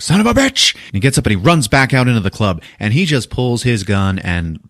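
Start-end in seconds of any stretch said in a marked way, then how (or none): 0.0-0.7 very high, loud cop curse; mock outburst. Cop realising the killer is near, high and loud. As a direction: spit it out in a hammed-up yell. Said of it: "he's like".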